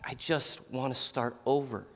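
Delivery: soft, understated imitation of crying; hushed retelling of someone crying; retold tearful plea. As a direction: quietly voice a worn-out, tearful plea. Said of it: "she's just crying and crying to me".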